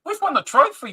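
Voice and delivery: high-pitched brag about the trophy; excited boast. Real, not acted. Gamer bragging to viewers about their trophy, excited.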